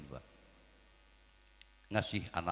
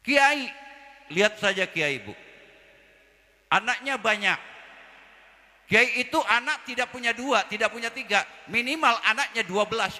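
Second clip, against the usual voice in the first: high, loud teasing sermon voice; loud preaching; 8 dB louder than usual. Acted. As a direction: rouse the crowd with a joke. Preacher teasing the kiai loudly. (ceramah lantang sambil bercanda)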